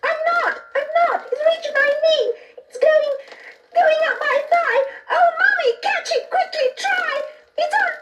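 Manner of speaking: put-on very high, shrieking, crying little child; a child screams to mummy as the thing crawls up; panicking child. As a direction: squeal and wail as it crawls up. Child feels creature climbing.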